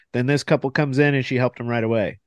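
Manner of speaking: ordinary talk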